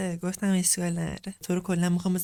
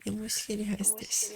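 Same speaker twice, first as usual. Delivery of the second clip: hushed confession under the breath; secretive whisper; 2 semitones higher, 7 dB quieter than usual. Pretend.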